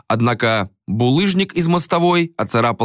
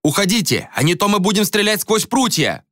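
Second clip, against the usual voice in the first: higher, stern warning; threatening shout; 11 semitones higher than usual. A narrator's reading. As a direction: warn them off, shouting. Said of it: "закричали они".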